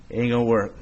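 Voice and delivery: low, flat-out doubting grumble; doubtful Peter. Retold or imitated. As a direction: sound like a tired skeptic.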